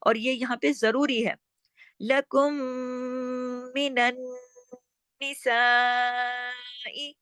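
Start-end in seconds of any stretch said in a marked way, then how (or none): 2.0-7.2 slow Quranic recitation, long held syllables; elongated recitation. Shown as a demonstration. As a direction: draw out the saa of निसाई on a level tone. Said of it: "अब सा को क्यों खींचा?". (निसाई के सा को लंबा खींचकर)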